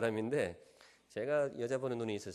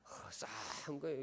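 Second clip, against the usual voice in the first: drawn-out breathy whisper, much softer; chilly hush; 10 dB quieter, slower than usual. Retold or imitated. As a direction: let an awkward chill hang on a slow, hushed breath. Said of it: "어, 분위기 이랬어요". (싸늘하게 숨죽여 속삭이듯)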